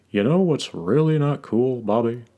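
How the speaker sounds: deadpan dad punchline; Hank Hill voice. Retold or imitated. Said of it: "the way he puts extra emphasis on, like, H words".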